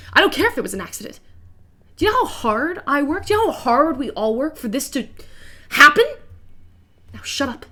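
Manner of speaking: loud, fast, rising rant; furious boss. Acted. Boss ranting at worker about how hard they work, loud and fast. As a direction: hammer him with every question.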